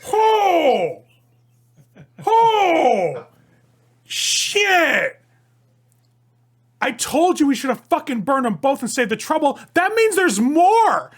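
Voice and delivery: very high, very loud frantic yells; panicked yelling. Acted. Newberry seeing the window: panicked screaming. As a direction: scream it out in a panic, stretched and slow. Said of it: "He's like".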